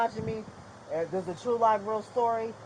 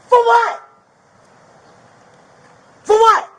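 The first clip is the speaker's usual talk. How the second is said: high, very loud angry demand; angry shout; 10 semitones higher, 11 dB louder than usual. Real, not acted. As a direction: fire it back at the officer, furious. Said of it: "Why are you getting so angry?".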